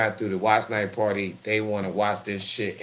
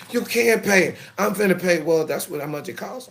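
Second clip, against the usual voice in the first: put-on high, louder girlish protest; mock daughter arguing; 10 semitones higher, 7 dB louder than usual. Daughter refusing her father's money, high loud protest. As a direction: argue back as the daughter.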